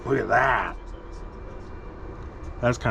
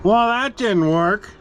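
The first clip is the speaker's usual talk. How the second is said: mock dismay; 9 semitones higher, 4 dB louder than usual. Real, not acted. Print failed.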